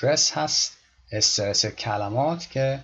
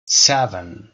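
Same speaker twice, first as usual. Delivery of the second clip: "Seven" with the "se" punched much louder; loud stressed first syllable; 2 semitones lower, 8 dB louder than usual. Shown as a demonstration. Teacher demonstrating emphatic stress on "Seven" for the class. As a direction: hit "se" in "Seven" hard. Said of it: "یعنی سه بلندتر شنیده بشه".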